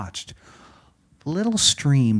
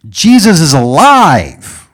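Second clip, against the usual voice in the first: loud, high, drawn-out proclamation yell; old preacher shouting over a crowd; yelling at full strength; 9 semitones higher, 14 dB louder, slower than usual. Retold or imitated. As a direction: cry it out as loud as you can. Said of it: "he yelled as loud as he could".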